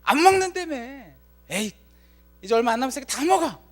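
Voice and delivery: little brother angrily giving up his ramen; angry outburst. Retold or imitated. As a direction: let the frustration burst out fast. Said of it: "화가 나서 그렇게 말하죠".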